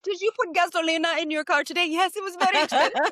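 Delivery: high-pitched playful role-play; playful mock exchange. Joking about gasolina in the car, high mock exchange. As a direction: act out a silly little question and answer.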